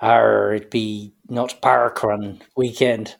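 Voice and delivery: slow hammy pirate talk; badly faked pirate accent.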